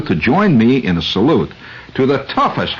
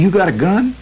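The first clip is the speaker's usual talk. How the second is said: worried attendant; 10 semitones higher than usual. Retold or imitated. Attendant asking the driver if he is armed, in a high worried voice. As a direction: ask it like a jittery local. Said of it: "He said".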